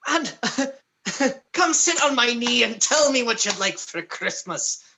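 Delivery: high-pitched, playful Santa-style voice; jolly gift-giver. Acted. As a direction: invite them up like a department-store Santa.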